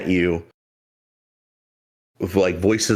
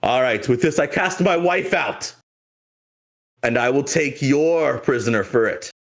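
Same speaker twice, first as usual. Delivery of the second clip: high, declaiming captain voice; pirate captain; 6 semitones higher than usual. Acted. Captain casting his wife out in trade, declaiming role voice. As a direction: proclaim the exchange with pitch soaring, then falling. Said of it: "And he goes".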